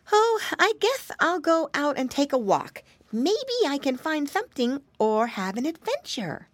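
put-on high, curious piggy boy; little pig voice. A narrator's reading. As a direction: wonder aloud like an eager piglet. Flop plans a walk.

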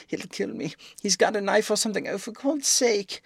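high panicked pleading; frantic terror. A narrator's reading. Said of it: "frantic dives".

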